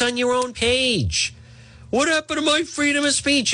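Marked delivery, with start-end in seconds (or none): 1.9-3.5 mock whine. Mocking banned posters' whine.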